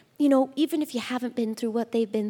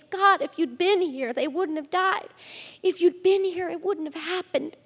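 distraught plea to God; 6 semitones higher than usual. Acted. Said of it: "How many times have you been in that place?".

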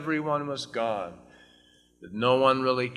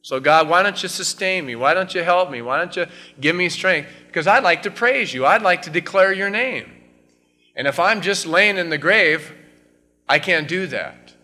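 higher and louder, pleading with God; impassioned preaching; 7 semitones higher, 7 dB louder than usual. Acted. Preacher voicing the psalmist's plea to God, impassioned. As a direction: cry out the psalmist's plea at full force.

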